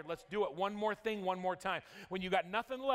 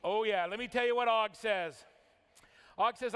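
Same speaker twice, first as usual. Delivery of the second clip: ordinary talk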